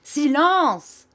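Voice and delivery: high, loud outburst; sudden cry. A narrator's reading. Teacher silencing an unruly class, loud cry. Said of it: "cria monsieur Mel en se levant tout à coup".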